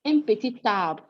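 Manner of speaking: "Un petit arbre" ending in tarbre; with liaison. Shown as a demonstration. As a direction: link "petit" to "arbre" with a clear T. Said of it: "what you hear is ta".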